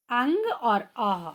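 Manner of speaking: अंग और अह drawn out very slowly; slow demo. Shown as a demonstration. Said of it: "ये हैं".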